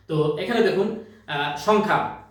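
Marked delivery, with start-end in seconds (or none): none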